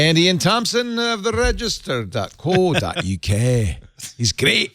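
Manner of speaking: high, drawn-out mock Scots accent. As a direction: put on a playful, high-pitched Scottish lilt. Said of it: "start talking with a Scots accent".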